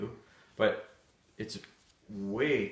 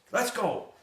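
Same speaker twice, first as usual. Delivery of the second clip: high, urgent call to a dog; hollered command; 11 semitones higher, 3 dB louder than usual. Retold or imitated. Trainer re-enacts getting on the lost dog, high hollered command. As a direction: pitch it up like calling a dog in. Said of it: "I'm on her with my voice".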